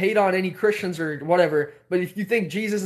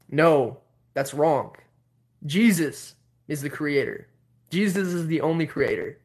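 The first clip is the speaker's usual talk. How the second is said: imitating stern believer; 3 semitones lower than usual. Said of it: "let me get real close to tell you guys how he said it".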